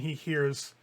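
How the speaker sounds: normal talking voice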